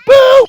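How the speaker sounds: high, loud spooky boo; high loud ghostly boo. Acted. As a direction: shout it to scare the bear. Ghost scaring.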